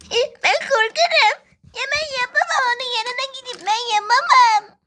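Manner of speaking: a frightened small character begging off; scared whimpering. Acted. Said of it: "Ya ağlama".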